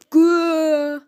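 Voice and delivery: loud, drawn-out growl; imitated dog growl. A narrator's reading. Slime turned dog tries growling at the cats, growl. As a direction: growl like a dog trying to scare. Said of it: "Growl at them".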